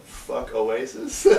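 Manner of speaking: loud, drawn-out, breaking into laughter; laughing punchline. Real, not acted. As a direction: stretch it out loud, then crack up.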